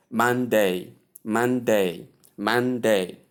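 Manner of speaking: Monday said as man-day, two joined syllables; clear Monday. Shown as a demonstration. Teacher drilling Monday slowly for the class. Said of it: "you combine the two sounds".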